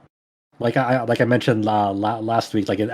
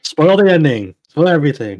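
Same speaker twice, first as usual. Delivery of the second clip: playful loud call, pitched up; mock urging; 4 semitones higher, 5 dB louder than usual. Co-host jokingly urging his podcast partners to spoil the game, mock loud call. Jokey urging, then just kidding.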